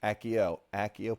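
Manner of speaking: Accio with a hard K sound, ak-ee-oh; hard double C. Shown as a demonstration. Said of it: "the hard double C".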